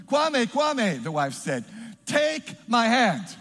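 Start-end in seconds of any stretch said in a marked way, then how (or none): 0.1-1.0 loud, higher acted call. Wife to drowning husband, loud acted calling. 2.1-3.3 loud, high, slowly stretched words; commanding call. Acted. Wife reaching out to drowning husband, slow loud acted call.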